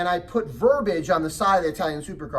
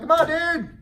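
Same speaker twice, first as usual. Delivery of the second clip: higher, louder impatient call; 11 semitones higher, 5 dB louder than usual. Retold or imitated. Dad urging his stubborn son, raised high call. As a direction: hurry him up, voice raised and high. Father calling son again.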